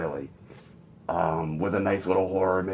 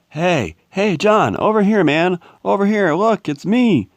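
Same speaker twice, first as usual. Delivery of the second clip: high, loud shout across a distance; calling out; 12 semitones higher, 9 dB louder than usual. Acted. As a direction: yell to get his attention. Calling to John from afar.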